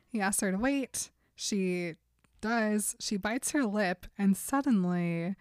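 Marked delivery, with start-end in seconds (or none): none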